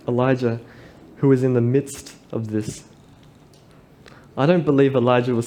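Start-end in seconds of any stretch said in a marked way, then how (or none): none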